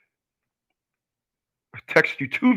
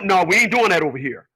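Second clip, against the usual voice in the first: ordinary delivery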